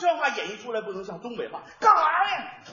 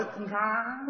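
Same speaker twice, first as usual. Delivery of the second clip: Beijing accent; 6 dB quieter than usual. Shown as a demonstration. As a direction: drop the voice Beijing style. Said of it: "声音往下一点".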